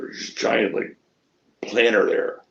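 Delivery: ordinary talk, nothing marked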